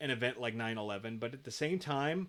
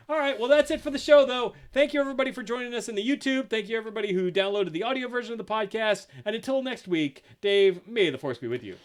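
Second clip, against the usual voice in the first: high, loud host outro; announcer sign-off; 8 semitones higher, 8 dB louder than usual. Acted.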